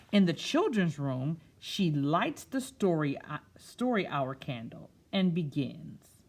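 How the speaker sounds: picture-book narration; storytime reading. A narrator's reading. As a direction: read it to a room of children. Reads picture book aloud.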